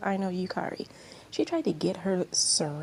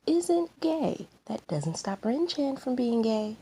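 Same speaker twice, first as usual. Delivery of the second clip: much higher, sing-song jab; mocking rebuttal; 7 semitones higher, slower than usual. Aino mocking Reina, high sing-song jab. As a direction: twist the knife playfully.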